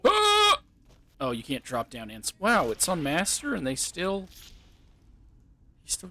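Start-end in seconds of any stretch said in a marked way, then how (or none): 0.0-0.6 sudden high scream of fright; startled scream. Real, not acted. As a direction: shriek at the jump scare. Jump scare in game.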